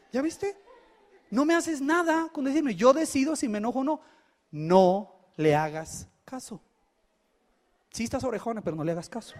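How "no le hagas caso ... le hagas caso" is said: the father soothing his daughter; calm fatherly advice. Retold or imitated. Father calmly advising.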